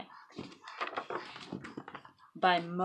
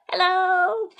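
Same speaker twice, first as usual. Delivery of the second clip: much higher and louder hello; surprised call; 11 semitones higher, 11 dB louder than usual. Acted. Elephant calling up to flying Piggy, higher and louder. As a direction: call up in amazement.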